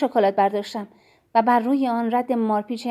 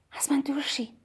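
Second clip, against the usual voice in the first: whispered, softer, falling at the end; quiet; 2 semitones higher, 6 dB quieter than usual. A narrator's reading. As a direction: breathe «از من دور شی» in a hush. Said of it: "با صدای آرام گفتم". (با صدای آرام و نجواگونه)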